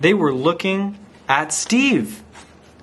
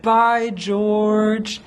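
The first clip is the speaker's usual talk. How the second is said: higher, drawn-out farewell call; kids calling goodbye; 6 semitones higher than usual. A narrator's reading. The kids all said it to George, called goodbye. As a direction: sing out a goodbye like a group of kids.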